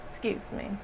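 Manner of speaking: hoarse, soft and strained; losing voice. Real, not acted. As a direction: voice going hoarse. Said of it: "I feel like I'm losing my voice".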